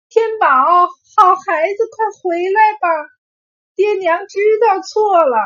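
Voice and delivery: high, loud pleading call; parents calling out. A narrator's reading. Parents calling their vanishing son home, shouting in apology. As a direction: cry out to bring the child back. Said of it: "对着金光的小孩喊".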